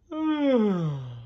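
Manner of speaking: long high falling sigh; drowsy bear sigh; sleepy sigh. Acted. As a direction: sigh as if drifting off. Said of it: "I'm a bit sleepy".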